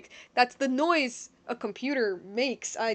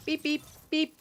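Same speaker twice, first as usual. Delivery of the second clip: robot voice; 4 semitones higher than usual. Pretend. Streamer voices the robot's beeps.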